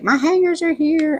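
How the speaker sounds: bubbly, delighted report; excited friend. Retold or imitated. Friend texting excitedly, voiced.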